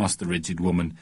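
ordinary delivery

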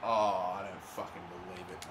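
a low, gutted mutter; stunned disbelief. Real, not acted. Gamer gutted by a goal, low stunned murmur.

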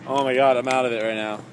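ordinary talk, nothing marked

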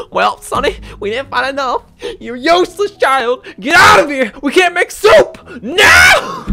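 put-on high shrieking old woman scolding; rising from scold to tearful screech; angry grandma. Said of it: "Grandma was about to fucking whack us on the fucking head and be like".

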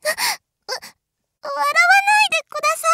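flustered plea. Acted. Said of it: "笑わないでください".

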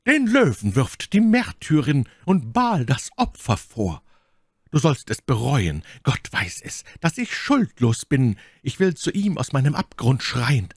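high, declaimed, solemn proclamation; furchtbar machtvolle Stimme. A narrator's reading. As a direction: proclaim it like a martyr. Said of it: "rief mit ihrer so süßen Stimme". (machtvoll deklamierend, feierlich)

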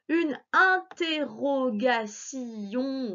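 very slow, "interrogation" cut into beats; "interrogation" drawn out syllable by syllable. Shown as a demonstration. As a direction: stretch "interrogation" to find the si. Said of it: "lui, on le met".

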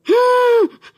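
high loud gasp of shock; sudden mock-scared gasp; loud startled gasp. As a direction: gasp like you just woke in fright. Pretending to wake from dream.

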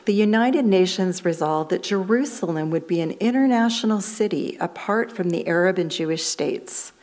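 ordinary talk

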